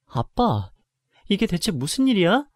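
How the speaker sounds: high, loud alarmed question to dad; shocked question. Acted. Daughter seeing her dad's wrecked stall, shocked.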